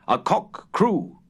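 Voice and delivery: usual speaking voice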